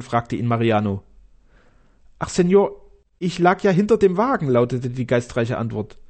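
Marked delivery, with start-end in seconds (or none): 2.2-4.5 high-pitched excuse from a cowardly servant; narrator's high comic servant voice; sheepish coachman. A narrator's reading. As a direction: plead your silly excuse in a raised voice. Cowardly coachman makes his excuse.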